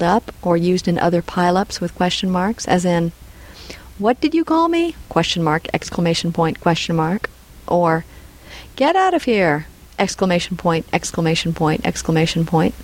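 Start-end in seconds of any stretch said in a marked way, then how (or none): 4.0-5.0 high-pitched, incredulous challenge; outraged question. Shown as a demonstration. Example of heavily punctuated outraged question. 8.8-9.7 higher-pitched, sweeping falling cry; emphatic exclamation. Shown as a demonstration. Example with three exclamation points.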